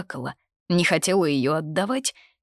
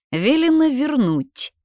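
plain reading voice

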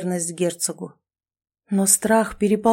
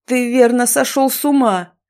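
loud, higher furious roar; 5 semitones higher, 5 dB louder than usual. Acted. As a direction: roar it in fury. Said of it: "взревел Роксолиус".

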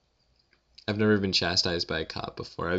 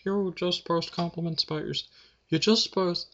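mocking critic voice; 10 semitones higher than usual. Imagined critic mocking him.

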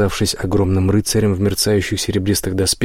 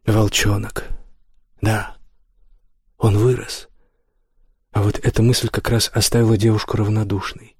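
usual speaking voice

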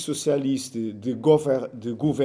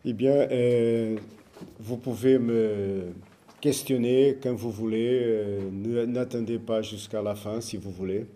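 Portuguese accent in French; French spoken with a Portuguese accent; foreign accent in french; 3 semitones lower than usual. Real, not acted. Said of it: "mon français n'est pas déjà si bien".

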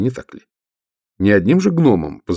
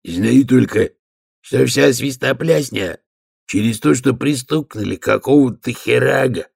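grumbling; 5 semitones higher than usual. Acted. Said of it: "буркнул гонец".

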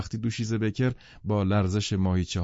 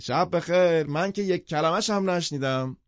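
much higher call, tossed back over the shoulder; calling from stairs; 10 semitones higher than usual. Acted. Jordan calling goodnight down from the stairs, high and carrying.

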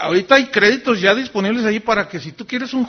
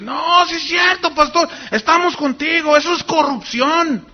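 imitating pastors shouting support; 10 semitones higher, 4 dB louder than usual. Other pastors joining the protest.

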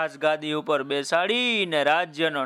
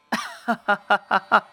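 light laugh; 3 semitones higher than usual. Real, not acted. Teller laughs at demon's plight.

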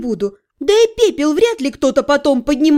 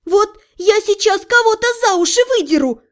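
yelling; 7 semitones higher than usual. A narrator's reading. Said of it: "завопила я".

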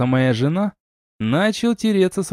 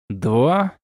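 ordinary delivery, nothing marked

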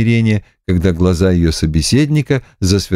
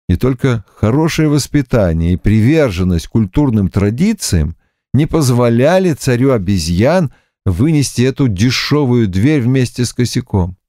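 usual speaking voice

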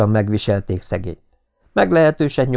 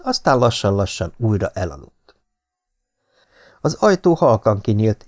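usual speaking voice

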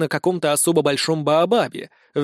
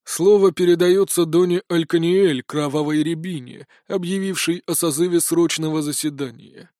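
formal announcement. Acted.

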